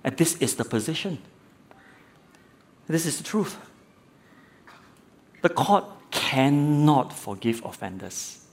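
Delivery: measured, matter-of-fact ruling. Retold or imitated. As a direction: state it as simple fact, without harshness. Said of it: "he said it as a very matter of fact".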